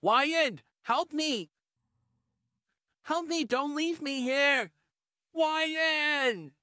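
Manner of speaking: high, pleading cry; pleading for help. Acted. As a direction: beg not to be left. House voice cries for help.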